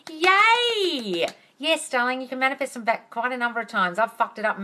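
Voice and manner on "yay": high, bright cheer; high excited cheer; excited yay. Real, not acted. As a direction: cheer for the viewer. Cheering comment.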